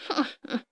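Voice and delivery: short villainous laugh; sinister chuckle. A narrator's reading. Mole boss advances on Carmelito with a sinister chuckle. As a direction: chuckle menacingly at cornered prey. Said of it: "田鼠普老大狞笑着".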